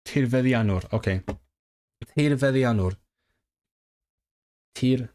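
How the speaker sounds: ordinary delivery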